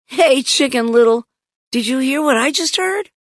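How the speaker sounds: put-on high-pitched, urgent kid voice; agitated little girl; excited questioning. A narrator's reading. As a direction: ask it high and keyed up. Girl questions her shouting brother.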